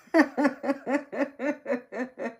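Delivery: big, high burst of laughter; loud laugh. Real, not acted. Reacts to chat story.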